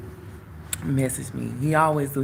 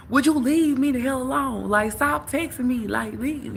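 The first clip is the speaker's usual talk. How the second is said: cursing him out; 11 semitones higher than usual. Retold or imitated. Cursing out the guy who keeps texting, higher angry scolding. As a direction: tell him off hard. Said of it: "I started cursing him out".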